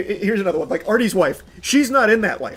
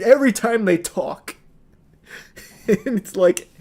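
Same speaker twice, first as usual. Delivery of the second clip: ordinary talk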